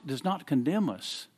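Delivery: ordinary talk, nothing marked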